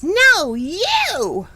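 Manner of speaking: put-on high, loud childish "No, you!"; mock kid squabble. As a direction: play the squabbling kids tossing it back. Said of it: "Everything is".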